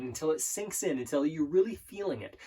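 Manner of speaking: usual speaking voice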